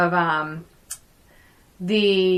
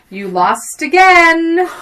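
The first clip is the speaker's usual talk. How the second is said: slow, higher, drawn-out taunt; hammed-up quote of a film line; famous movie taunt; 6 semitones higher, 4 dB louder, slower than usual. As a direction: stretch it out like a gloating rival. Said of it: "that famous little line".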